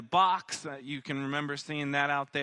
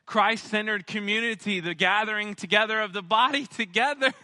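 laughing; 7 semitones higher, 6 dB louder, faster than usual. Real, not acted. Laughs through vision recap.

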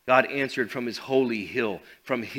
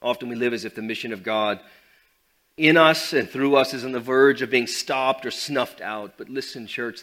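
ordinary delivery

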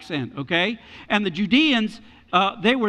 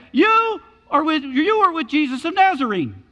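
accusing; 8 semitones higher, 4 dB louder than usual. Acted. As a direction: point the finger at him out loud. Servant girl accuses Peter, acted.

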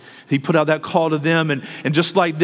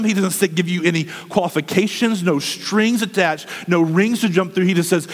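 ordinary delivery

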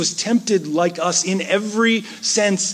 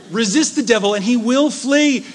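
louder and higher, laughing through it; joyful proclamation; 6 semitones higher, 4 dB louder than usual. Acted. Preacher repeating the verse to the congregation, loud and laughing. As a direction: cry it out with a laugh of triumph.

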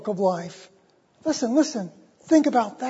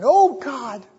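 Knox's prayer; 7 semitones higher than usual. Retold or imitated. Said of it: "Her husband saying".

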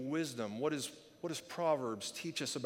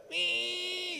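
very high, held "wee" like a moped; thin steady high squeal; imitated motor whine. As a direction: mimic a straining little engine. Imitates motor straining toward shore.

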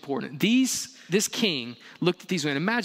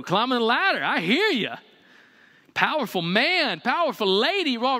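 higher, hammed-up gushing admirer; admiring neighbors; 6 semitones higher than usual. Neighbors admiring the debt-bought car, mock gushing praise. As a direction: gush over the new car.

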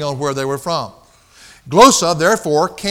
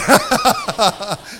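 high shrieking laugh; 5 semitones higher than usual. Real, not acted. After the waiter's punchline, a big high laugh. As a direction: break up at the punchline.

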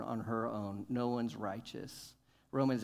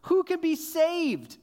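much higher, slow, loud astonished cry; 14 semitones higher, 8 dB louder, slower than usual. Acted. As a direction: voice the stunned disciples. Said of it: "They were exceedingly astonished".

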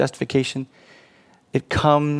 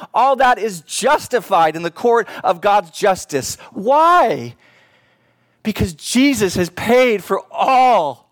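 rising, louder, fervent sermon peak; full-force preaching; 8 semitones higher, 3 dB louder than usual. Acted. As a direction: pour it out to the congregation with mounting fire.

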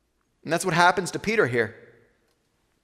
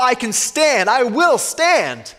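higher, slow mock-proud vow; imitating a proud disciple's boast; 6 semitones higher, slower than usual. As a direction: voice Peter's cocky confidence, a bit higher. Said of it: "He was proud".

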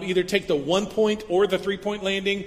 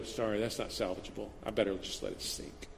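dismissive Jesus; 8 semitones lower, 12 dB quieter than usual. Retold or imitated. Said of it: "Jesus would go".

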